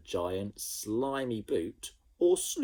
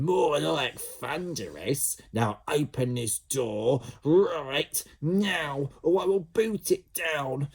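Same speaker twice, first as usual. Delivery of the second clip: high, loud, slow demanding big-sister voice; bossy sister; 7 semitones higher, 3 dB louder, slower than usual. A narrator's reading. Jemima ordering Ned to open the bathroom door, loud and bossy. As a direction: lay down the law through the door. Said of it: "angry sister at the door".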